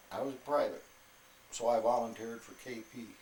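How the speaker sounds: normal talking voice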